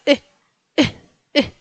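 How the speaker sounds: short "E" pushed from the chest; hiccup-like E. Shown as a demonstration. Teacher likens Polish Y to hiccup, short chesty "E". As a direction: jolt out each "E" as if hiccuping. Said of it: "como cuando tienes hipo".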